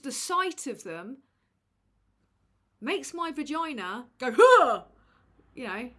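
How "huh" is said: loud, high "huh"; sudden exaggerated gasp of revulsion; big comic recoiling noise, much louder than the talk; mock recoil gasp. Imitating a body part recoiling in disgust.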